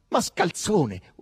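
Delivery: loud, drawn-out insult; indignant, slow, high retort; angry retort. A narrator's reading. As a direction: hurl it back, stretched and high. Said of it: "replicò lei, voltandosi".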